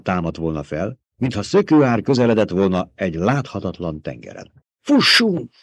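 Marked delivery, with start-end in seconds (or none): 4.8-5.5 high panicked cry, louder; scream. A narrator's reading. Jessica to her son as the worm comes, screaming. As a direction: shriek it in terror. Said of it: "sikoltotta Jessica".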